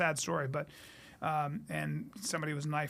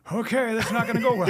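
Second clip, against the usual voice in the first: laughing and louder, bracing for the worst; laughing dread; 4 semitones higher, 8 dB louder than usual. Retold or imitated. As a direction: chuckle through it, knowing you're about to get crushed. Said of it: "Tyler was like".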